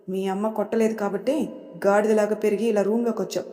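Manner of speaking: ordinary delivery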